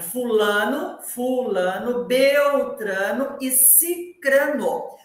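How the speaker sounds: fulano, beltrano e cicrano, each name slow with a pause after it; slow careful listing. Shown as a demonstration. Teacher recites the order of the names slowly for listeners. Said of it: "a ordem".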